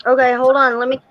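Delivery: ordinary talk, nothing marked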